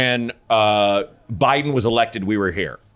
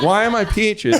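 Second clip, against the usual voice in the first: baffled; 8 semitones higher than usual. Retold or imitated. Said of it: "I'm like".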